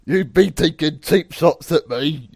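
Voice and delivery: put-on loud, high, slow accented yell; wrestler promo shout. Wrestler accusing his rival of cheap shots, loud mock promo.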